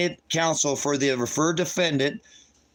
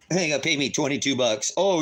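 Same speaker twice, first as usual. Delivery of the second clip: ordinary talk, nothing marked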